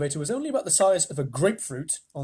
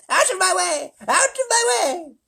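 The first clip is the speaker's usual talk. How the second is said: put-on high, loud old-lady shriek; shouting; 6 dB louder than usual. Grandma Georgina crashing about, shrieking at everyone. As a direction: barrel through yelling.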